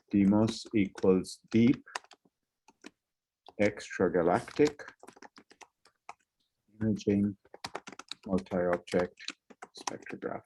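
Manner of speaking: slow, trailing dictation, fading away; slow dictation while typing. Shown as a demonstration. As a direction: spell it out slowly as you type. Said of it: "I'm going to write this in the chat".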